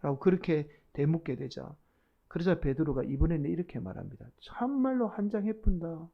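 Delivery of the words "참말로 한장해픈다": exasperated Gyeongsang dialect denial; plead, then disown him in thick Gyeongsang dialect; cursing in Gyeongsang dialect. Acted. Peter cursing Jesus to be believed, exasperated Gyeongsang dialect. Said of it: "막 그렇게 막 예수님께 저주를 퍼부은 거예요".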